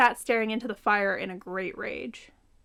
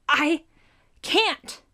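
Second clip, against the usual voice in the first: loud, much higher anguished shout; desperate cry; 6 semitones higher, 6 dB louder than usual. A narrator's reading. Melly answers Tony amid the tornado, desperate cry. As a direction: cry out that you can't hold it.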